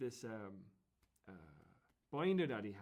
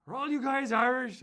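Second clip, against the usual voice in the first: loud, higher-pitched incredulous question; exasperated cop; 10 semitones higher, 14 dB louder than usual. Retold or imitated. Cop checking a line of Irish lads, loud and exasperated. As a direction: raise the voice like an officer addressing a group.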